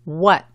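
"What" with the final T left unpronounced; unreleased final T. Shown as a demonstration. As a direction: say "What" with a slight U before a long A, T dropped. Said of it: "la T cuando es al final de la palabra".

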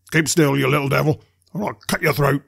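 loud, rough-mannered threat; menacing order, forcefully voiced; terrible convict voice. A narrator's reading. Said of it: "cried a terrible voice".

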